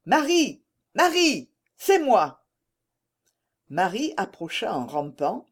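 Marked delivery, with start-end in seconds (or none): 0.0-2.4 high, rising-in-volume searching call. A narrator's reading. Germain calling to Marie where she hides, calling out. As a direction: call her out of hiding, pitched high.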